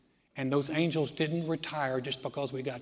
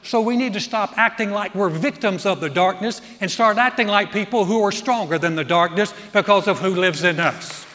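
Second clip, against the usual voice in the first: raised, louder pulpit delivery; full-force preaching; 7 semitones higher, 11 dB louder than usual. Real, not acted. Preacher rallying the congregation against darkness, preaching at full force. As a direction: preach it high and loud.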